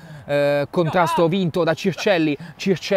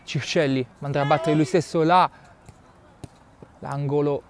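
usual speaking voice

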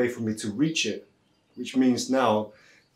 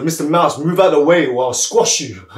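louder, higher mock threat; bullying elephant; 3 semitones higher, 7 dB louder than usual. Powerful elephant ordering Mister Mouse aside, bullying. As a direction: throw your weight around as a storybook elephant.

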